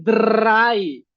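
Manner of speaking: "drei" with a long rolled r; drei austriaco. Shown as a demonstration. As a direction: roll the r in a drawn-out "drei". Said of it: "la R se pronuncia". ("drei" con erre vibrante)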